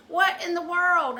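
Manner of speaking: high, loud, drawn-out disbelief; mock outrage. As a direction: scold in exaggerated disbelief. Acted telling-off.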